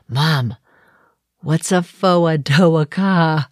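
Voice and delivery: put-on puzzled child copying Boston car; Boston accent. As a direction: kid asking slowly in Boston accent. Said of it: "gotta love the Boston accent".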